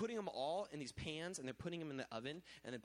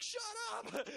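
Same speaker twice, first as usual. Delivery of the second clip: very high squeaky comeback; playful high-pitched shut-down; mock retort; 4 dB louder than usual. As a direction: snap back in a silly high voice.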